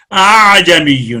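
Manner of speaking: اعجمی drawn out very slowly, the second hamza softened between alif and hamza; tasheel on اعجمی. Shown as a demonstration. Teacher demonstrating tasheel to students, slowly drawn out. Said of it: "دونوں مشکل ہیں".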